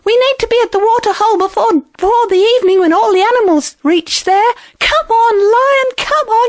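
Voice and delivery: high, fast, pressing hare voice; high-pitched, impatient little animal; urging hare. Acted. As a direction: hurry the straggler along. Hare hurrying the lion along.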